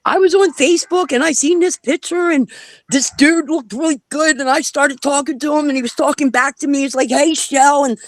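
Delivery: mock-innocent friend explaining the Facebook chat; girlfriend retelling. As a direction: babble it like the embarrassed friend. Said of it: "She's like".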